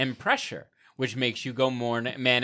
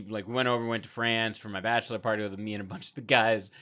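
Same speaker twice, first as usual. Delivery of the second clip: ordinary talk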